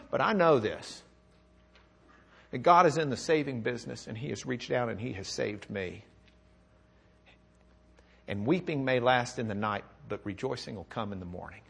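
suddenly low, soft conviction; soft, low, reassuring close after the outburst; quiet assurance. Acted. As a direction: drop to a hushed, settled confession of faith. Confession drops to quiet assurance.

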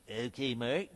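low, lip-pursed professor; professor's lip voice. Retold or imitated. Telling a friend about his Hebrew professor, lip-pursed imitation. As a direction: mimic the old professor's mouthy way of talking. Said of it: "he kinda talked like this and did his lips".

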